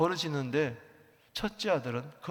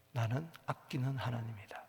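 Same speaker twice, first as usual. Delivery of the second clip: soft whisper; 5 semitones lower, 6 dB quieter than usual. Acted. God's inner voice quoted softly.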